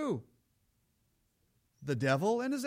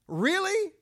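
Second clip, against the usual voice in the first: incredulous; 11 semitones higher, 7 dB louder than usual. Real, not acted. Preacher rebuking hypocrisy.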